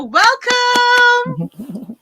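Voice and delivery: high, loud welcome yell; excited shout. Real, not acted. Host welcomes guests on stage.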